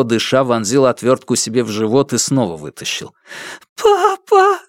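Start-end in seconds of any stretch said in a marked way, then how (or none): 3.8-4.6 put-on very high girl's sob; little girl sobs for her father, very high; sobbing cry. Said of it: "всхлипнула девочка".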